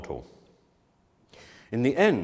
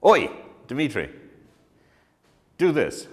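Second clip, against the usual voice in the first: 1 bossy director; 4 semitones higher, 6 dB louder than usual. Retold or imitated. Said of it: "you say".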